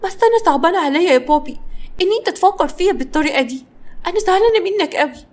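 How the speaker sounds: high, hammed-up hurt child; mock-sad, wounded and high; put-on sadness. A narrator's reading. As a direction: play sorry for yourself to daddy. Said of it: "وطت جانا راسها في الأرض وبحزن".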